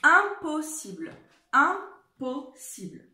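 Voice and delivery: emphatic slow dictation; clear dictation. Shown as a demonstration. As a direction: announce the word, then stretch it for the class. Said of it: "on écrit le mot".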